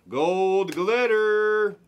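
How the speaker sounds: high, very slow stretch of Gold glitter; drawn-out excited card call; slow hyped call. Real, not acted. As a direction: savour each syllable of glitter like a big pull.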